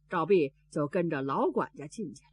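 ordinary delivery

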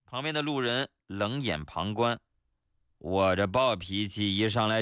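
ordinary delivery

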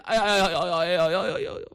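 一连串哎呀声; 夸张地连声哼唧，渐渐收弱; 装疼呻吟. A narrator's reading. 朱仝揉腿装疼连声呻吟. (连声叫疼)

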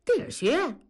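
high-pitched baffled echo; puzzled. A narrator's reading. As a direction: repeat it back, not understanding. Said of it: "同志没听懂". (没听懂地反问)

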